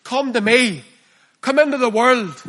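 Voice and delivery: the preacher voicing the world calling sinners, enticing call; the enemy's lure. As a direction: beckon them like the tempting enemy. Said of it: "And the enemy says".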